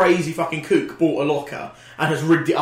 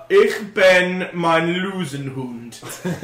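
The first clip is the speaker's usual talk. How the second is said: very slow fake-German accent; laboured, made-up German; drawn-out mock German, inventing words as you go; pretend German; 3 semitones higher, much slower than usual. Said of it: "I can pretend I know".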